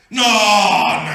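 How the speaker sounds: loud, drawn-out mock protest; flat-out refusal. Husband refusing his pastor's order to bless wife, protesting. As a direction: burst out with a long objection.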